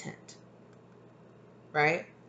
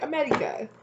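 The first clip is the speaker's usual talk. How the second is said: America in a mock thick foreign accent, pitched much higher; hammed-up foreign accent, "Amedica" squeaked up high; exaggerated replay of the heard accent; 11 semitones higher than usual. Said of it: "in a really thick accent".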